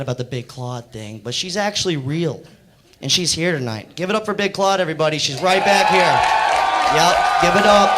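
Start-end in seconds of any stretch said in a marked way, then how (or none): 3.9-7.9 loud, high call for applause; rallying the crowd. Real, not acted. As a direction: shout it out like a host. Calling for audience applause.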